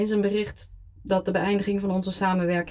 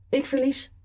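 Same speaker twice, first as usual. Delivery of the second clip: higher, pleading ik verlies; 6 semitones higher than usual. Acted. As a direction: push ik verlies up in a begging whine. Repeated admission of defeat.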